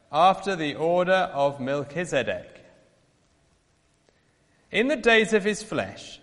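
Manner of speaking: usual speaking voice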